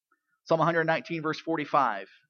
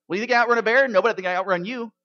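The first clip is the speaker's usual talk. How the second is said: joke punchline voices; 6 semitones higher, 8 dB louder, faster than usual. Retold or imitated. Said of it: "One of the hunters says".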